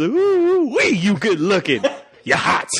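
high whooping mock admiration; smitten with himself. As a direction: whoop high, then leer at your double. Imagined clone admiring his own looks.

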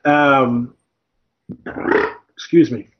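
pausing mid-sip of beer; drinking beer. Real, not acted. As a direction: talk just after a swallow of beer. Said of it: "I'm drinking beer".

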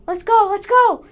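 high, loud urgent yell; shouting. Acted. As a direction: shout to hurry everyone. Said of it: "they shouted".

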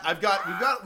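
ordinary delivery

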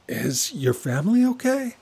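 very high, anxious-sounding question; pretended concern. A narrator's reading. Amina asking as if the break-in were real, pretended concern. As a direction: lift it right up, acting newly worried. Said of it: "She tries to pretend".

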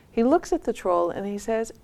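usual speaking voice

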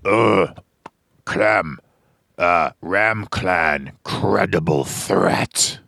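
slow, deliberate Viking warrior. Acted. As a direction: announce it gravely like a Viking. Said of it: "something I might say if we were Vikings".

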